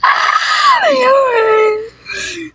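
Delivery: laughing shriek. Real, not acted. Laughing fit after hiccup joke.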